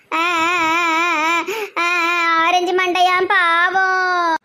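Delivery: long drawn-out sobs, then words spoken very slowly through tears; sobbing. Acted. Girl sobbing over the injured boy, very slow tearful words. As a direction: cry it out slowly.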